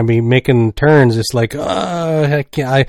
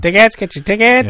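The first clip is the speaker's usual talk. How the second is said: higher hawker voice calling out; pushy ticket tout; 8 semitones higher than usual. Acted. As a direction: call out tickets like a scalper. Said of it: "Scalpers at the front door".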